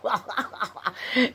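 a real burst of laughter at her own story; laughing. Real, not acted. Laughing at her own threat to Brian. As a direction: let the laugh roll out.

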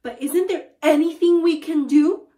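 high, loud desperate plea; panicked cry for a remedy. A narrator's reading. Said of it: "cried Missus Twit".

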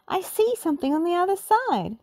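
high excited call; Daphne crying out. A narrator's reading. Daphne calling to friends, high and excited. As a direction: point it out eagerly. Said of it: "Daphne cried".

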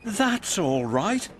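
slow, high, forgiving reply; drawn-out high character voice; Ned's character voice. A narrator's reading. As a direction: reassure slowly in a high little voice. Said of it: "said Ned".

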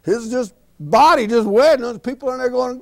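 ordinary delivery

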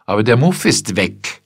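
loud wounded outburst; blurted out. A narrator's reading. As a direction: burst out with wounded pride. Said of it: "mit der sie hervorstieß".

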